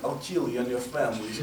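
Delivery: put-on comic Russian accent threat; a Russian guy's accent, played for laughs. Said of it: "when a Russian guy speaks".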